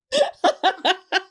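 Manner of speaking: high burst of laughter; real laugh. Real, not acted. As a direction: crack up at your own story. Laughs at her own story.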